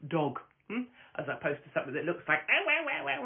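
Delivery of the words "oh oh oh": mock squeal. Mocking a tiny dog as rodent.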